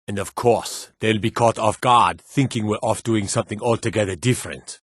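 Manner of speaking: usual speaking voice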